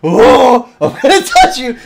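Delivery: high, loud playful menace; hammed-up spooky tease; creepy teasing. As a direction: stalk them with the pads. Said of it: "going like".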